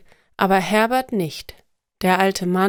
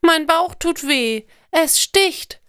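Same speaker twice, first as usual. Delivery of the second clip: put-on high-pitched hurting little girl; acted whimpering complaint, pitched well up; tearful child; 9 semitones higher, slower than usual. A narrator's reading. Little girl in pain tells her mother, tearfully. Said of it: "sagt das Mädchen und weint".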